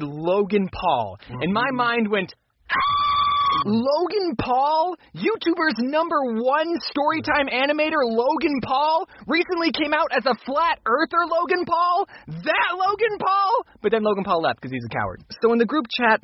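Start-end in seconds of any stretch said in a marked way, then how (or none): 2.7-3.6 sharp high gasp; shocked gasp. Acted. Mind reacting to Logan Paul. 3.6-13.6 higher and higher, slow disbelief; incredulous. Acted. Mind shocked at Logan Paul.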